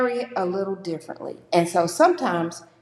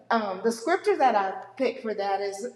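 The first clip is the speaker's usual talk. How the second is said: ordinary talk, nothing marked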